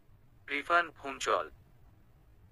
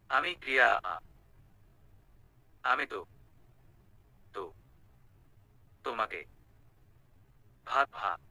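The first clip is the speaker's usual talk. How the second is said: nervous stammer; 4 semitones lower than usual. Acted. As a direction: choke on the words in panic. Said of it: "এভাবে তোতলাচ্ছে".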